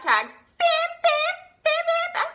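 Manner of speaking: very high little bams; squeaky-high playful bam bam; playful sound effects. Pretend. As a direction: pop each bam high up. Playfully placing hashtags.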